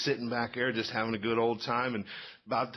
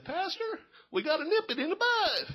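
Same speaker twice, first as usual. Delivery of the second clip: high-pitched, comic character voice; Barney Fife. Acted. Teenager answering the pastor from the back pew, high comic impression. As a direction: pipe it up high, urgent and silly. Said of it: "I got my best Barney Fife voice".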